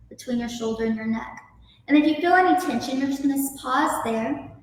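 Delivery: calm, unhurried yoga teacher guiding the breath; soothing instructor voice leading a neck stretch; guided yoga instruction. Acted. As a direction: talk the class gently through each stretch. Leads a guided yoga breathing practice.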